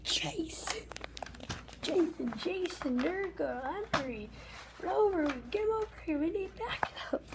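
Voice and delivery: hushed, urgent calls to the pets; whispering so nobody hears. Real, not acted. Kid hiding, whispering for backup, hushed and urgent.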